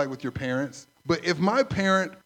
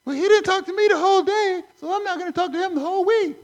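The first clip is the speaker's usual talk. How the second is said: high-pitched mock wife; much higher, a hammed-up petty wife; whiny wife voice, pitched way up; 13 semitones higher, 4 dB louder than usual.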